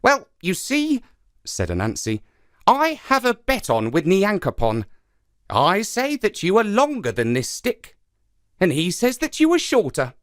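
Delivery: high, sly spider voice; lively trickster spider, eager and sly; Anansi the trickster. Acted.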